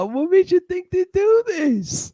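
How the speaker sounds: high incredulous disbelief. Real, not acted. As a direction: squeal it in disbelief. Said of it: "reacting to bad kit".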